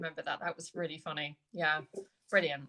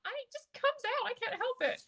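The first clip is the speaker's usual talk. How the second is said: much higher, laughing helpless protest; helpless excuse. Retold or imitated. Answering the kids about her accent, much higher and laughing. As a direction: squeak it out with a laugh, can't help it. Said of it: "and I'm like".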